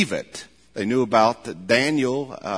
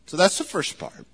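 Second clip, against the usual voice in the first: normal talking voice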